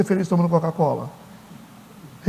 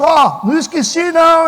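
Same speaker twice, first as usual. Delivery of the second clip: teasing reminder; 7 semitones higher, 4 dB louder, slower than usual. Retold or imitated. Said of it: "Ele passa".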